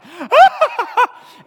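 loud high burst of laughter; a real big laugh at the terrified disciples. Real, not acted. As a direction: crack up loudly.

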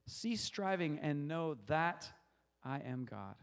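slow up to THAT, stop, then drop; weight the word that and let it hang; stress on that. Shown as a demonstration. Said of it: "I'm gonna go to the next word".

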